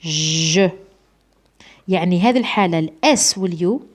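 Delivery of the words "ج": ج as a long buzzing "zh"; pronunciation demo. Shown as a demonstration. Teacher isolates the sound for students, sustained zh demo. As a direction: hold ج as the zh in measure. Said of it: "ننطقوها".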